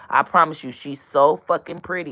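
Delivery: normal talking voice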